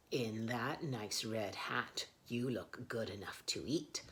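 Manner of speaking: low, sly whispered greeting; sly fox whisper. Acted. Fox whispers to the trapped owl, sly. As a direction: purr it like a hungry fox. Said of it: "he said".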